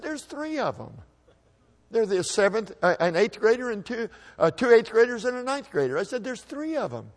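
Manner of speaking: put-on high, panicky kid's voice; a bullied boy panicking to his brother; scared protest. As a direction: plead in a frightened squeak. Scared kid pleading with brother.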